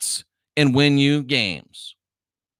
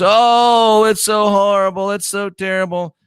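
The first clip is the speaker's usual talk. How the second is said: high, wailing mock complaint; mock griping; 7 semitones higher, 4 dB louder than usual. Host mimicking athletes moaning about inequality, mock griping. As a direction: imitate people moaning, loud "oh" then whiny. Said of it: "all these people gripe and moan".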